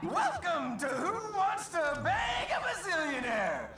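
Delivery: booming host welcome; game show host. Acted. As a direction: announce it like a TV host.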